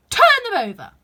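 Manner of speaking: high, loud, angry command. A narrator's reading. The Queen ordering the Knave, angrily. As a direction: shriek the order in a temper. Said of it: "turned angrily away from him and said".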